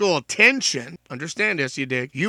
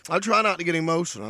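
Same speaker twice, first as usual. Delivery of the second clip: ordinary delivery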